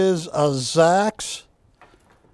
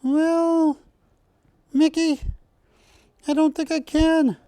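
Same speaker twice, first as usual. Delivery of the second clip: much higher, coy cartoon mouse girl; high, coy refusal as Minnie; 10 semitones higher than usual. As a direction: play hard to get as a squeaky Minnie. Minnie answers Mickey in skit.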